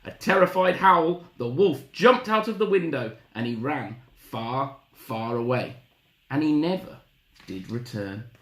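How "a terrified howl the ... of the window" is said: loud, high, excited storytelling; dramatic narration. A narrator's reading. Storyteller as the wolf flees, loud excited narration. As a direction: race through the escape excitedly.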